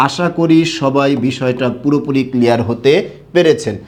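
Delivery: normal talking voice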